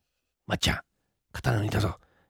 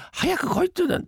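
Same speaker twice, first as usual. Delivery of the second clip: same complaint jumps much higher; impatient call; 14 semitones higher, faster than usual. Acted. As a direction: fling it out high and fed up. Waiting for late friends, grumbling. (高い声でじれったそうに)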